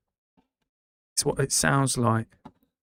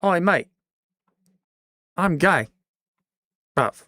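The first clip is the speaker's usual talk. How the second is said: much higher, louder hammed-up British call; mock British shout; 5 semitones higher, 5 dB louder than usual. Streamer hamming up a British voice for chat. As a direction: bellow it like a cartoon Brit.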